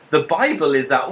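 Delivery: ordinary delivery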